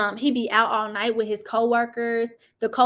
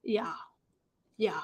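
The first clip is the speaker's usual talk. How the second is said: ordinary talk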